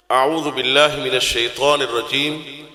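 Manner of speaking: slow, low, chanted tajweed recitation; Quranic recitation. Acted. Preacher reciting Quran to the congregation, slow chant. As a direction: intone the verses slowly and solemnly.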